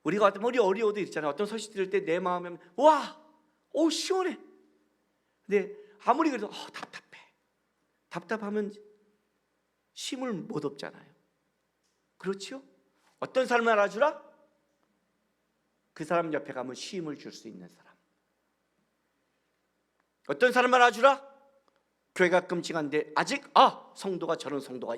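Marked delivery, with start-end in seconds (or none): 2.8-4.4 mock delight. Acted relief at good news. 6.5-7.2 sighed frustration. Retold or imitated. Acted frustration at news. 20.3-21.4 emphatic repeat. Shown as a demonstration. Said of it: "repeated question louder".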